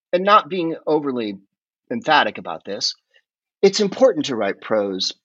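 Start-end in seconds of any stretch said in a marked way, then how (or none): none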